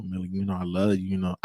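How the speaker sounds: very low, soft sweet-talk; butter them up in a hushed deep voice; smooth-talker's put-on bass murmur. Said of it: "trying to put on my D voice".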